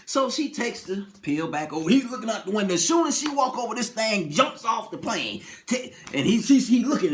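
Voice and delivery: ordinary talk, nothing marked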